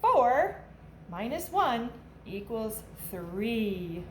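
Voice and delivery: put-on lively storybook read-aloud for kids; animated picture-book narration for a class; reading to children. A narrator's reading. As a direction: read it like a teacher at story time, bouncy and clear.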